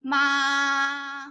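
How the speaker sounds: MA held long and level; MA on one steady pitch, no movement; first tone. Shown as a demonstration. Teacher repeats first tone for students, long and flat. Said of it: "this is the first tone".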